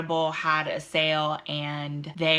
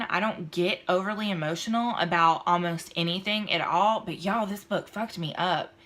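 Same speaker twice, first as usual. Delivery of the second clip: ordinary talk, nothing marked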